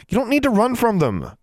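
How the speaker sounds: high-pitched, impatient snap; snapping. A narrator's reading. Girl frustrated with a boy who ran, snapping. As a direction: scold impatiently, pitch well up. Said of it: "she snapped".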